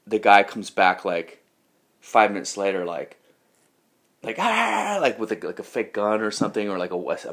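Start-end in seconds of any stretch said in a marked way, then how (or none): 4.4-5.0 high drawn-out "aah"; imitated yell of a charging attacker; comic attack cry, pitched way up; mock battle cry. Attacker returns charging with fake weapon.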